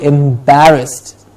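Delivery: "Embarrassed" slowly with open A in BAR; slow "Embarrassed", the A fully heard; clear A sound. Shown as a demonstration. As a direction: say "Embarrassed" stretched as em-BA-rust. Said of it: "A sound to be heard very clearly".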